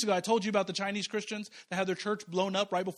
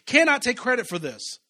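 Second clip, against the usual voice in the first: preaching; 5 semitones higher, 8 dB louder than usual. Acted. Loud preaching.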